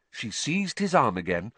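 plain reading voice

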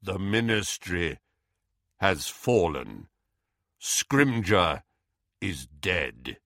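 low, slow, grave messenger voice; Kingsley Shacklebolt's deep slow voice. A narrator's reading. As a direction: deliver it low and unhurried. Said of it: "loud, deep, slow voice of Kingsley Shacklebolt".

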